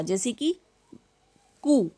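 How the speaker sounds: usual speaking voice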